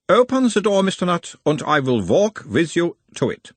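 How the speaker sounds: put-on high, probing analyst in Uberwald accent; Uberwald-accented therapist, pitched well up; mild Uberwald accent. A narrator's reading. Nutt as analyst asks about his mother, Uberwald accent. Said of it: "asked in a mild Uberwaldian accent".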